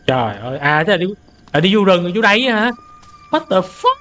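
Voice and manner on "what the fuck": high-pitched startled swearing; shocked disbelief. Real, not acted. Streamer spooked at being sent into the forest, shocked. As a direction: let the pitch leap in disbelief.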